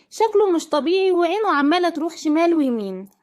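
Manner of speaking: plain reading voice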